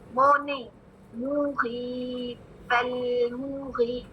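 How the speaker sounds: ordinary delivery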